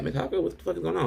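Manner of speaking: fast, high, startled outburst; shocked yell. Retold or imitated. Teacher, shocked by the dead girl, blurts it out high and fast. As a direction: blurt it out in alarm. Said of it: "the teacher are both like".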